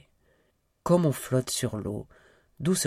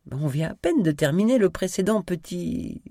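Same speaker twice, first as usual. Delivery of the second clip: higher-pitched playful protest; mock indignation; 7 semitones higher, 3 dB louder than usual. A narrator's reading. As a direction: act lightly scandalized, teasing. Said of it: "s'offusqua-t-elle en riant". (faussement offusquée, voix plus aiguë)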